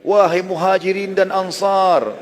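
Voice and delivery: high, loud, drawn-out call to a crowd; imitating a rallying cry; retold shout. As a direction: call out slowly as if from atop a high rock. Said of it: "berdiri di atas batu yang tinggi teriak".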